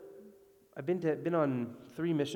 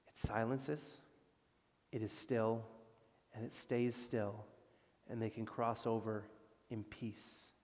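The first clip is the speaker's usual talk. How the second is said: soft, low, settling voice; gently hushed, with long pauses; hushed peace; 3 semitones lower, 9 dB quieter than usual. A narrator's reading. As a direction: let each phrase fall quieter into peace. Telling the storm stilled into peace.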